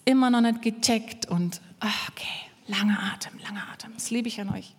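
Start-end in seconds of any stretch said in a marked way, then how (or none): 1.8-3.9 breathy, hushed, trailing softer; patient self-calming. Acted. Preacher acts a patient helper, quietly self-calming. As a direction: sigh and murmur it to yourself.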